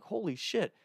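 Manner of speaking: high, hushed retold shock; shocked exclamation. Friends turn to see buddy pepper-sprayed, shocked.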